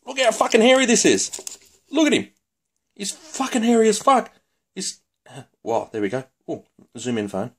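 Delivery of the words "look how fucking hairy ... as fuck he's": very high, incredulous comic voice; high-pitched exclaiming. Pretend. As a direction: squeal it in mock disbelief. Exclaiming at the hairy toy.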